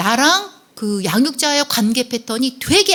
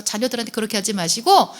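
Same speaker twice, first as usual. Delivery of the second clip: ordinary talk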